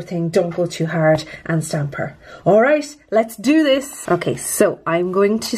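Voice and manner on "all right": usual speaking voice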